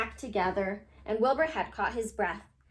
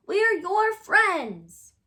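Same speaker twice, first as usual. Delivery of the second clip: earnest reassurance; 8 semitones higher, 7 dB louder, much slower than usual. Acted. Friends reassure Wilbur.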